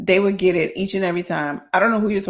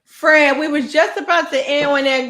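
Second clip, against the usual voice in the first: mock scold; 6 semitones higher than usual. Real, not acted.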